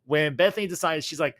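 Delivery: normal talking voice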